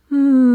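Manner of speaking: loud, high open hum; resonant hum. Shown as a demonstration. Coach demonstrating the nice resonant hum. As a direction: ring the hum out round and full. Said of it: "It is a nice".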